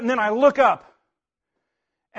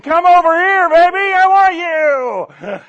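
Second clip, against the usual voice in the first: high, loud, slow come-hither call; hammed-up hen calling her tom; young hen turkey voice; 7 semitones higher, 8 dB louder, slower than usual. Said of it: "a young lady hen saying".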